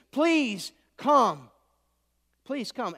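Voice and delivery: a touch of acted begging, loud then fading; pleading. Official begging Jesus to heal his son, mild acted plea. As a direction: plead twice, the second one dropping away. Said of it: "he's repeatedly pleading with Jesus".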